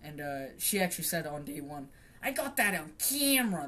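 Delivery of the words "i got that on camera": high, loud mock lady voice; high and loud, mocking the neighbor caught on camera; mocking old lady. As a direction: squeak it like an outraged neighbor. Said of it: "That's how she apparently sounded".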